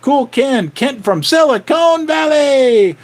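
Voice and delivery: energetic livestream shout-out; excited shout-out. Real, not acted. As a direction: call it out to the chat like a host. Excited live shout-out to viewers.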